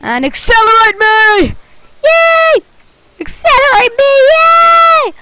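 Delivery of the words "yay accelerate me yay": excited launch cheer. Real, not acted. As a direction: whoop it at the screen.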